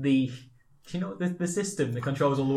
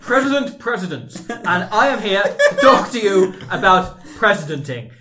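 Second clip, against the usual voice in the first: mock president; 5 semitones higher, 9 dB louder than usual. President addressing the nation on TV, mock pompous. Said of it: "I'll be the president".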